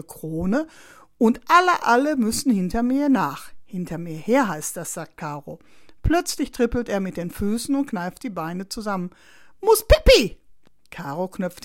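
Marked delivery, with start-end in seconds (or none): 1.2-3.5 put-on high, loud, emphatic child's voice; proud child, leaning hard on "alle"; excited little boy. A narrator's reading. Small boy boasts of his star role. 9.6-10.4 put-on very high, loud child's cry; sudden high-pitched urgent announcement; urgent little boy. A narrator's reading. Small boy urgently needs the toilet.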